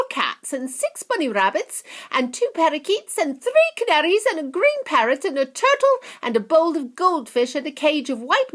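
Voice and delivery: high loud whining brat, building to a shriek; spoilt girl. Acted. Veruca demanding a squirrel, high loud tantrum. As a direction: list it all in a tantrum.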